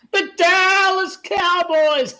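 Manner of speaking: laughing through the words; laughing mockery. Real, not acted. Streamer mocking the Dallas Cowboys, laughing hard. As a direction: cackle the name out, slow.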